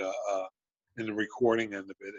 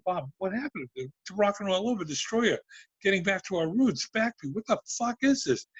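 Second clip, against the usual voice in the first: retold indignant protest; 9 semitones higher than usual. Retold confrontation after hearing album.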